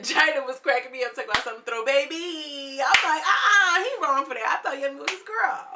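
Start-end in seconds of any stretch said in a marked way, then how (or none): 1.6-2.8 slow drawn-out mock voice. Quoting China slowly. 3.2-5.7 loud scandalized reaction. Retold or imitated. Reacting loudly in a quote.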